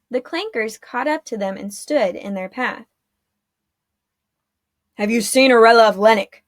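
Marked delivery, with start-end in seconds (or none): 4.9-6.4 loud, gruff guard demanding; a guard pressing the children hard; deep, scruffy demand. A narrator's reading. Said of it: "demanded in a deep, scruffy voice".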